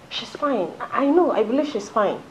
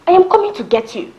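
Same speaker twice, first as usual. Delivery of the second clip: higher, louder, urgent reassurance; urgent call; 9 semitones higher, 7 dB louder than usual. Acted. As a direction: promise her you're on your way. Urgent promise on the phone.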